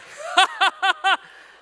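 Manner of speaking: high, loud burst of laughter; real loud laughter. Real, not acted. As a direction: laugh out hard. Laughing after quote.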